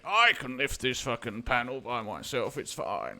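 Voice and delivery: mock grumble of workers who won't be told; a muttered, put-upon complaint, lightly acted; grumbling. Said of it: "it was just grumbles from them".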